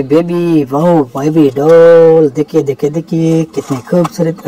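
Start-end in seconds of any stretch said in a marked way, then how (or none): none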